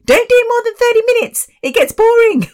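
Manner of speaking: very high, squeaky sister impression; mock nagging baby sister. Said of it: "who's always kind of told me".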